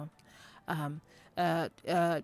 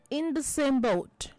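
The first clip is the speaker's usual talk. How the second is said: higher, slow and louder on in the same boat; slow, loud idiom; 7 semitones higher, 5 dB louder, slower than usual. Shown as a demonstration. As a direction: announce the idiom slowly to the class. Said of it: "announces next idiom".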